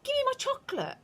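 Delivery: put-on high bratty boy voice; demanding boy. A narrator's reading. Bruno demanding his chocolate, high and bratty. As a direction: insist like a spoiled kid.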